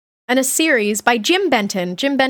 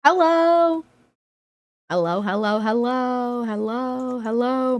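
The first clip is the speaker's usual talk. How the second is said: soft sing-song hellos; 3 dB quieter than usual. Real, not acted. Greeting her dog Budo.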